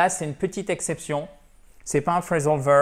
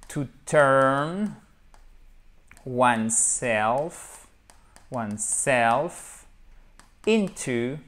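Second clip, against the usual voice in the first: word by word, with long pauses; drawn-out English syllables for learners; slow model phrase; much slower than usual. Shown as a demonstration. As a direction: dictate it very slowly. Teacher models the infinitive word by word.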